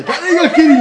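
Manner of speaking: high, loud, laughing "who's kicking?"; startled outburst. Retold or imitated. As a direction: blurt it out half laughing. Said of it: "みたいな".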